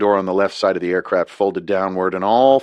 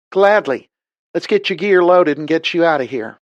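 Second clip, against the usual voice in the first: higher, louder, brisk southern pilot; eager southern woman; 9 semitones higher, 4 dB louder than usual. A narrator's reading. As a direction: snap into action, cheerful. Liz cheerful reply.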